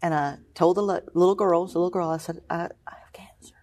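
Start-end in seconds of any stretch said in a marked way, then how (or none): 2.5-3.5 hushed, voiceless confession; retold whispered admission. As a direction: whisper it like a shameful word. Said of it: "'Cause you whisper it".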